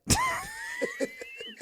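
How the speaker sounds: high squealing burst of laughter; shrieking laugh at his own corny line. Real, not acted. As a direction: howl with laughter.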